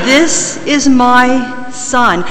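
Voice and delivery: This drawn out slowly, each word weighted; God's voice, slow and weighty; slow emphatic voice of God. Acted. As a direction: let "This is my son" land slow and solemn. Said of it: "for emphasis".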